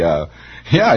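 usual speaking voice

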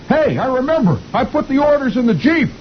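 very high cartoon officer voice; captain remembering. Captain realizes orders are in the jeep, very high squeal. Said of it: "The captain says".

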